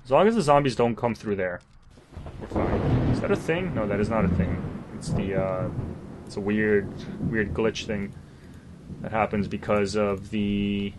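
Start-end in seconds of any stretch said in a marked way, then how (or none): none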